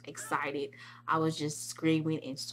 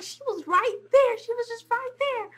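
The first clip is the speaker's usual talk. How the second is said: high, breathless-fast fan chatter; getting all excited; 7 semitones higher than usual. Retold or imitated. Fan telling others that Kelly's coming, excited high chatter. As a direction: gabble it high in giddy anticipation. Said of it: "I was just getting all excited".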